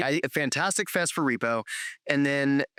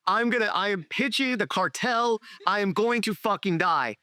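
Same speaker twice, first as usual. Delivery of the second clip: higher, half-joking retold dread; mock panic; 7 semitones higher than usual. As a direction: play up the terror as a funny memory. Pitching to cartel, retold panic.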